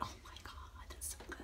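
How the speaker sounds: hushed amazed whisper. Retold or imitated. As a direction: whisper it in awe. Retold first tasting, hushed awe.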